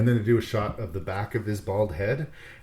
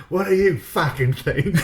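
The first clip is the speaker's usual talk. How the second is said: higher, louder put-on character voice; loud comic comeback; mock indignant retort; 8 semitones higher, 5 dB louder than usual. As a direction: snap it back as a hammed-up injured dude. Acts injured Aussie answering his buddy.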